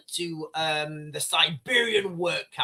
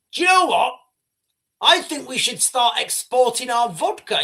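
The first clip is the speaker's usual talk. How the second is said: eager vodka producer; 5 semitones higher, 5 dB louder than usual. Retold or imitated. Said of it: "you're like".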